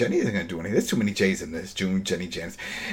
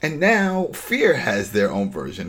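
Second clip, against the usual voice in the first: normal talking voice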